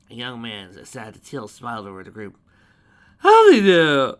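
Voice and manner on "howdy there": loud, high Southern country howdy; full country accent. A narrator's reading. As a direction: greet them like a country shopkeeper. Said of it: "in a full country accent".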